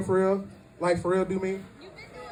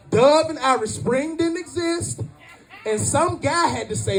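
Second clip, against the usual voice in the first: usual speaking voice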